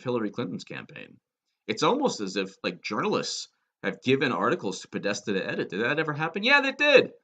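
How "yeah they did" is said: higher and louder, triumphant; emphatic answer. Real, not acted. Answering his own rhetorical question, triumphant and loud. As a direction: slam the answer home.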